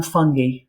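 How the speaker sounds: "fungi" said FUNG-ghee, hard g and ee ending; middle-of-the-road pronunciation. Shown as a demonstration. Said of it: "How do you pronounce it?".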